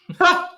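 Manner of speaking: sudden high laugh bursting out; real laugh, high and loud; burst of laughter. Real, not acted. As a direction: crack up at the punchline. Reacting to racist review story.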